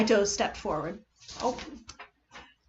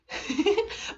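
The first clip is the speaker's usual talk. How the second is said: mocking laugh; 9 semitones higher than usual. Kokichi laughs at her.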